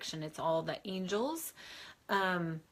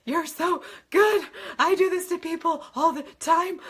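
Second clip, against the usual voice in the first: much higher and louder, hammed-up boast; mock boasting medium; 11 semitones higher, 12 dB louder than usual. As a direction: play the cocky medium bragging over a crying client. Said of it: "And I'm like".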